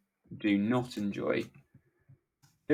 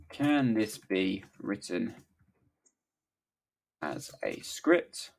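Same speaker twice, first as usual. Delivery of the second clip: very slow, word by word; halting dictation with long gaps; 3 semitones lower, slower than usual. Real, not acted. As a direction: spell it out as if typing along. Dictates the prompt while typing.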